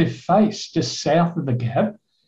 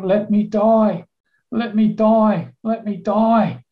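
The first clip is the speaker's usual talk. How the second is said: slow, higher begging; retold desperate plea; 6 semitones higher, slower than usual. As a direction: imitate an injured man pleading, drawing out each phrase. Injured man begging rescuer to die.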